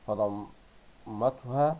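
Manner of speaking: "فضمتها" drawn out slowly in separate syllables; very slow sounding-out of "فضمتها". Shown as a demonstration. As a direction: break "فضمتها" into slow pieces, pausing inside the word. Sounds out word while reading.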